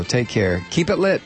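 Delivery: ordinary talk, nothing marked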